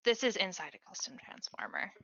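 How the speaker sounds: ordinary talk, nothing marked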